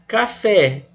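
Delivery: "Café" stressed on the last syllable. Shown as a demonstration. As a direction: punch "fé" in "Café". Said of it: "o fé era tônico?".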